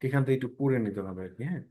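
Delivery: usual speaking voice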